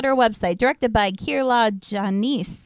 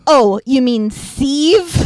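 "sieve" stretched out slowly as a question; slow, pointed question landing on "sieve"; corrective emphasis; 4 dB louder, much slower than usual. Retold or imitated. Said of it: "And I was like".

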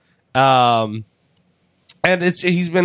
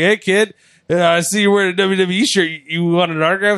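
higher, eager pushy pitch; imitating Sin Cara; 5 semitones higher, faster than usual. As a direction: try to tempt a kid into an autograph. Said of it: "and then Sin Cara's like".

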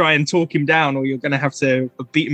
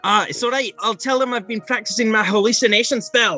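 higher Scottish lad, full of mischief; cheeky Scottish kid; 6 semitones higher, faster than usual. Acted. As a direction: brush it off with a grin. GM voices the kid.

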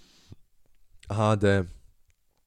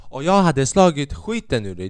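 higher, louder hammed-up Italian intonation; mock Italian rant; 7 semitones higher, 5 dB louder than usual. Cashier threatening customer over pizza, hammed-up Italian rant. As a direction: scold like an indignant Italian cook.

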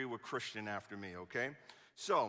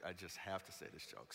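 much softer, muttered to himself; quiet aside; 6 semitones lower, 12 dB quieter than usual. Real, not acted. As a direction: drop the voice, half to yourself. Repeats line as quiet aside.

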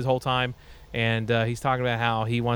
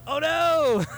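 high, far squeak of a dying mole; mock-squeal it like a cartoon mole; squealed Nicolas Cage mole; 13 semitones higher, 3 dB louder than usual. Mole crushed in the trash truck, high squeal. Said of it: "you hear him go".

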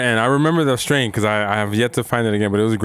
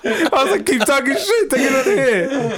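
much higher, laughing mock taunt; 12 semitones higher than usual. Taunting his too-high friend, squealing with laughter. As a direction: cackle the taunt through laughter.

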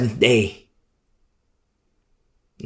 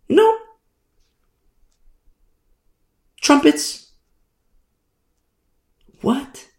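incredulous; 14 semitones higher, slower than usual. Real, not acted. Incredulous rhetorical burst.

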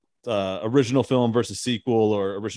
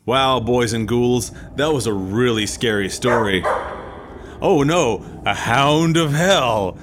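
high, hammy spooky show host; jack o lantern voice; 4 semitones higher, 4 dB louder than usual. Acted. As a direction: camp Halloween host voice, playing it up. Halloween show host persona.

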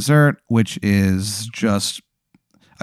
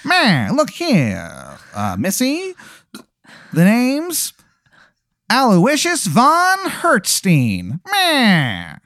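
high, slow wiseguy drawl; old-timey gangster introducing himself; 1920s gangster; 11 semitones higher, much slower than usual. Acted. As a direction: cactus gangster saying his name. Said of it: "he goes".

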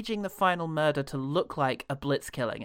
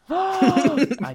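laughing; 6 dB louder than usual. Real, not acted. Laughing triumph at joke.

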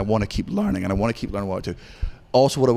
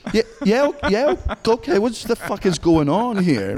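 confused self; 11 semitones higher than usual. Retold or imitated. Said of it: "And I was like".

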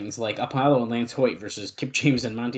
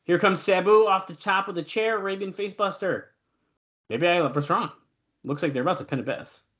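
wrestling commentator; 8 semitones higher than usual. Acted. Live play-by-play of watched match.

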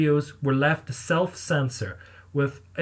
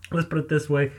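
ordinary talk

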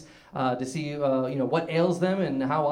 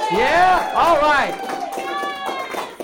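much higher, louder crowd-pumping yell; hype-up cheer; 11 semitones higher, 5 dB louder, much slower than usual. Acted. Talk-show host pumping up his studio audience, excited yell. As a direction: whip the crowd up, stretching the right.

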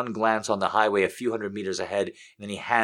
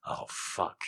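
breathy whisper; 2 semitones lower, 11 dB quieter than usual. Acted. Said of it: "he chants as he slams".

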